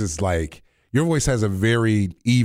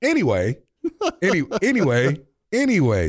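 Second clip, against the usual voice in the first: a touch higher, brighter anyway; young voice; 7 semitones higher than usual. Shown as a demonstration. Host putting his young voice back on after teasing. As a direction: try to sound young again. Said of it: "put my young voice on".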